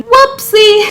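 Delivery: high, loud sing-song whoopsie; cheeky squeak; playful whoopsie. Pretend. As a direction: sing it like a caught kid. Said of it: "caught out".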